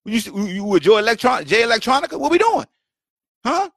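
teasing challenge. Retold or imitated. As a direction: poke at him like a buddy calling him out. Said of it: "So I'm doing that with everybody".

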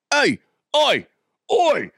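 high mock-British calling out; failed British accent. Host attempting British despite himself, bad high British accent. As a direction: try a British "oi", badly and pitched high. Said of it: "I can't do it".

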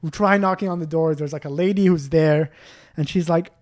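ordinary talk, nothing marked

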